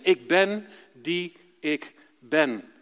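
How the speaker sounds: very slow, solemn and calm. Retold or imitated. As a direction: draw out each word of the name. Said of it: "heel rustig gelezen". (plechtig en heel langzaam)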